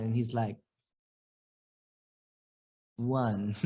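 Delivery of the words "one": put-on lower, softer, slow British accent; friend's British accent, low and shy; imitating British accent. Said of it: "he has a British accent".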